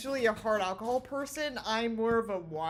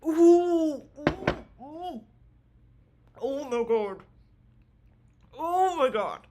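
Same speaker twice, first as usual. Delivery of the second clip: burning shot reaction; 5 semitones higher, 9 dB louder, slower than usual. Real, not acted.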